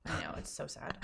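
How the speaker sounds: hushed, sad whisper. Real, not acted. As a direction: breathe it out sadly. Sad scene, friend's death.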